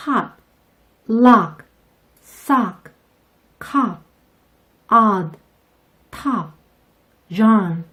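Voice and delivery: "hop", "lock", "sock", "cop", "odd", "top", "yarn" with an open a-like vowel; slow clear teacher model of the o as Korean 아; each word on its own, vowel like 아; model English short o. Shown as a demonstration. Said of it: "같이 한번 발음해 볼게요".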